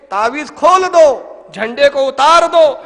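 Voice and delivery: loud, slow, firm but fond command; loving admonishment. Retold or imitated. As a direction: scold gently but with full force. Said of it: "محبت آمیز تنبیہ،".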